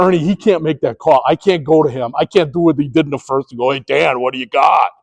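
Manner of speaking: low, soft, steady reasoning; calm explaining. Retold or imitated. Umpire calmly explaining to the upset coach, low and soft. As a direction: talk him down quietly.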